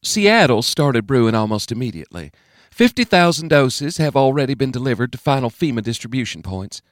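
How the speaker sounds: lightly voiced Southern governor briefing the President; a touch of a Georgia drawl, brisk and unflappable. A narrator's reading. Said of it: "never bothered to train away her drawl".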